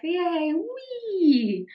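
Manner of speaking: quoting her silly playing, high playful glee. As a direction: squeal it like a kid on a swing. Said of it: "I was like".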